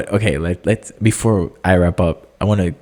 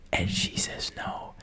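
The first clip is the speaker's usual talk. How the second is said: hushed whisper; 15 dB quieter, slower than usual. Real, not acted. Telling a dramatic spoiler.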